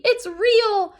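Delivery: high, loud frightened gasp; gasped in fear. Acted. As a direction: blurt it out terrified. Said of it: "gasped Piggy".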